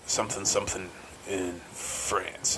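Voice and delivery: imitating a film trailer narrator; mock trailer narrator. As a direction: drawl it like a trailer announcer. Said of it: "the narration was like".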